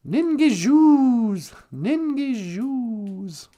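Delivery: "Ningijus" stretched very slowly; slow, drawn out. Shown as a demonstration. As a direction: draw out "Ningijus" lazily. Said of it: "if you're warm".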